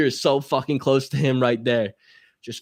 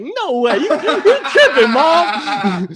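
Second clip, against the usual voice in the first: high, loud, slow denial through laughter; laughing denial; 10 dB louder, much slower than usual. Retold or imitated. Son denying running from cops to mom, laughing high. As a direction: laugh it off like she's crazy.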